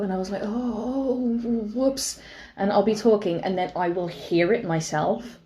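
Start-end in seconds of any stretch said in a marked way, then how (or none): 0.4-2.2 higher, drawn-out mock oops; sheepish realisation. Telling how partner caught their accent, sheepish. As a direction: draw out a sheepish, high "oh".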